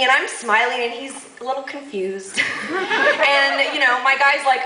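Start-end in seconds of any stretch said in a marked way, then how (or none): none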